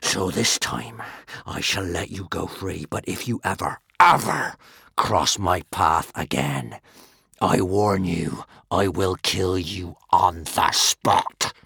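low, slow warning; slow menacing threat. A narrator's reading. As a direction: threaten him, deadly slow. Norbert threatening Hiccup.

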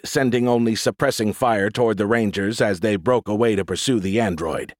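usual speaking voice

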